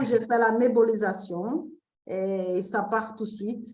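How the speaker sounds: Brazilian accent in French; French spoken with a Brazilian accent; foreign accent in french. Real, not acted. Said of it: "mon français, c'est pas très bien". (accent brésilien en français)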